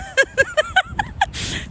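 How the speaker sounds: shrill, high burst of laughter; high squealing laugh. Real, not acted. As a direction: squeal with laughter at your own joke. Laughs at her own joke.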